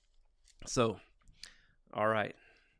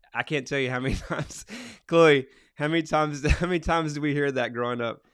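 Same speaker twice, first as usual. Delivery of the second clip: amused, laughing through the words; louder, quick, laughing recollection; real laughing; 3 semitones higher, 4 dB louder than usual. Real, not acted. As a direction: break into a laugh while reminiscing. Amused memory of family catchphrase.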